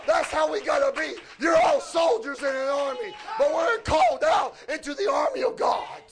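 punchy revival preaching; fervent preaching. Real, not acted. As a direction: preach it hard to an answering congregation. Preaches the testimony's climax.

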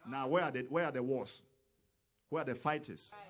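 loud, fast, rising fervor; fiery sermon climax; preaching at full force. Real, not acted. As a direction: drive it home like a revival preacher.

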